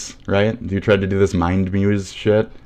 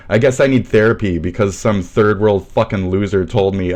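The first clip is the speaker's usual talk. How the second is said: usual speaking voice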